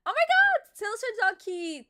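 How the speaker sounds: very high, loud excited exclamation; thrilled squeal. Real, not acted. Streamer thanking viewer for super chat, thrilled high squeal. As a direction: squeal it with delight.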